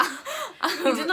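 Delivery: laughing friend cuts in at the botched accent. Real, not acted. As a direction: break up laughing at the bad accent.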